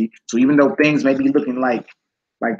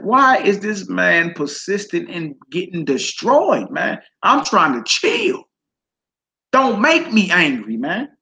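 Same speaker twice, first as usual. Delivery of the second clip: higher, slow, strained as if holding back rage; mock angry Judah; 6 semitones higher, slower than usual. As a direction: play Judah barely keeping his Hulk in. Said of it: "Judah got so mad".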